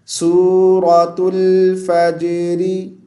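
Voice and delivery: slow, drawn-out chant of the title; recited surah title. Shown as a demonstration. Teacher answers his own question with a chanted title. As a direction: intone the name like recitation.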